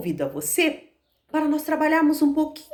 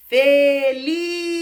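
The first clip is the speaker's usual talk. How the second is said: happy; 3 semitones higher, 6 dB louder than usual. Shown as a demonstration. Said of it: "Ou você está".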